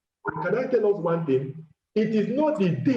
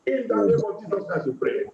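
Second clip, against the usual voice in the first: normal talking voice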